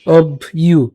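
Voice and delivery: "অভ ইউ" broken into obh, pause, iyu; split syllables. Shown as a demonstration. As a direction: say "অভ", stop, then "ইউ". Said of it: "রস্যকারের জন্য ইউ".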